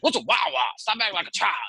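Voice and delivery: high-pitched mock Southern scolding; Big Daddy voice. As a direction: imitate a Southern buddy telling someone off. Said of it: "deep Big Daddy voice".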